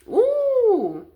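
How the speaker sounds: a high, loud, delighted "ooh" at the card. Real, not acted. Tarot card reveal delights her.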